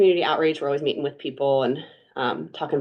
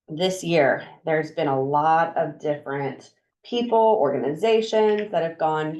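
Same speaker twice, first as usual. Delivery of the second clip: normal talking voice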